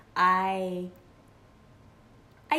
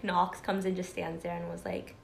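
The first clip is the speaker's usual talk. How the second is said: ordinary talk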